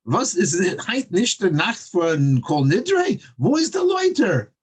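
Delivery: put-on high, pleading Yiddish-accented cry; pleading belt. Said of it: "he belted me".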